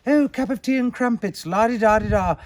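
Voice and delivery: high-pitched, hammed-up British accent; mock British accent. Imitating people attempting British talk about tea, mock British accent. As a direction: put on a silly British accent, pitched well up. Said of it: "trying to do a British accent".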